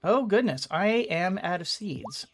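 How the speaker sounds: ordinary talk, nothing marked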